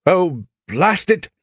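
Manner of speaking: high, slow, exasperated British curse; a fussy Englishman dropping his phone; British annoyance. Acted. Diplomat drops phone.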